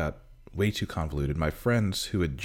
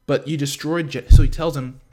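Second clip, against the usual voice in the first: ordinary talk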